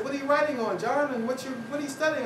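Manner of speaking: high, eager colleague voices; quick, nosy colleague questions; curious colleagues. Retold or imitated.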